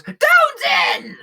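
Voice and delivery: very high, loud shout; calling out. Acted. Bug hailing the orc on the docks, high shouted call. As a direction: holler the name in a high character voice.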